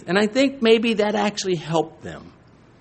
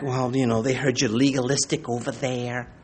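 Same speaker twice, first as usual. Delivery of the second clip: lower, softer gossip murmur; gossiping whisper; 6 semitones lower, 7 dB quieter than usual. Retold or imitated. Church members gossiping about the pastor, hushed and low. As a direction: pass on church gossip under the breath. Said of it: "sometimes the whispering gets back".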